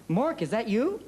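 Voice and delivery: high, laughing mom calling him Mork; a hint of a surprised mother; mom's voice. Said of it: "I called home".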